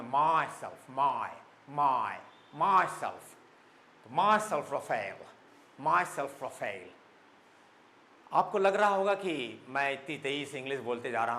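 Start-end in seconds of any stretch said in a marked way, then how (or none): 0.0-6.9 Western-accented "myself", drawn out with a stressed first syllable; Western style. Shown as a demonstration. Teacher showing students a Western-style, stressed "myself". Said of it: "western style में".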